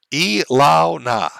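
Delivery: slow, drawn-out E Lao Na, each syllable apart; phonetic sounding-out. Real, not acted. Streamer reading a donor's phonetic message, E Lao Na sounded out slowly. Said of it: "having me phonetically say something".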